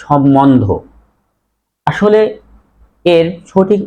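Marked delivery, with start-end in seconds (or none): 0.0-0.6 সম্বন্ধ said as shommondho, no b sound; common mispronunciation. Shown as a demonstration. Teacher shows students the common slip, mispronounced. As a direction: double the m in সম্বন্ধ, drop the b. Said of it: "আমরা অনেকেই উচ্চারণ করি".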